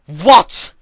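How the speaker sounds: loud, high startled yell of confusion; confused yell. A narrator's reading. Twilight yelling in shock at the reviving corpse. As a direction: shout it out, bewildered. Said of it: "she yelled out of confusion".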